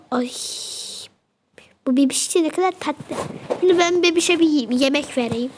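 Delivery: normal talking voice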